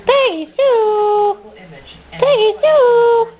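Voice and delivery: high, slow drawn-out call. Real, not acted. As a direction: stretch her name out up high to call her. Calling the dog, drawn out.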